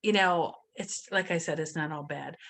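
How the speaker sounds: ordinary talk, nothing marked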